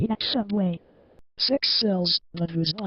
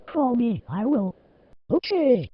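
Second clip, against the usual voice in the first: ordinary talk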